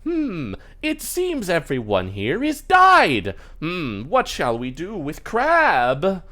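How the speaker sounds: high, loud puppet voice with drawn-out words; slow, theatrical puppet musing aloud; puppet character. Acted. Puppet wonders what to do with Crab, stagey musing.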